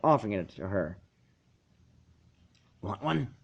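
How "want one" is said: soft, hesitant offer; tentative. A narrator's reading. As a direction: ask shyly, barely sure. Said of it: "he asked tentatively".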